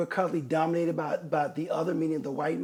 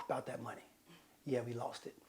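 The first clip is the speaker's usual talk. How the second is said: sheepish; 6 semitones lower, 15 dB quieter than usual. Pretend. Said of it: "I come back".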